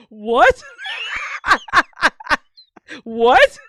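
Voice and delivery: loud startled laughing what; shouting with laughter. Real, not acted. Streamer shouting at a clip, laughing loudly. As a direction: howl with laughter at the screen.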